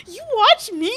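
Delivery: high, loud squealed question with laughter; giddy, incredulous squeak; starstruck disbelief. Retold or imitated. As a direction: re-enact delighted fangirl shock. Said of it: "kicking my feet so bad".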